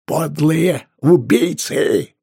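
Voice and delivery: high, loud, drawn-out cry; anguished accusation. A narrator's reading. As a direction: curse the murderers slowly and bitterly. Innkeeper accusing the killers. (протяжный гневный крик)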